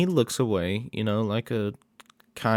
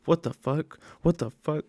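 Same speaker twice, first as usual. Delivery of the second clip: higher, mock-startled repeat; a retold jolt of shock, pitched up; startled outburst; 6 semitones higher than usual. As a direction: blurt it out like you just got spooked. Nikki startled in bed.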